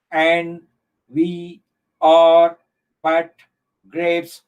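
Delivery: halting, evenly spaced words with pauses; syllable counting. Shown as a demonstration. Teacher counting ten syllables for the class, word by word. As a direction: set each word apart as if counting.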